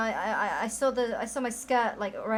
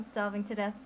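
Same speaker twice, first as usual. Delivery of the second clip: ordinary talk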